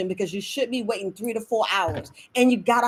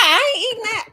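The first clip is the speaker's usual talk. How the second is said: higher, louder mock disgust; imitating a skeptical viewer refusing; mock refusal; 10 semitones higher, 4 dB louder than usual. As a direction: do the picky eater turning it down. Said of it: "You be like".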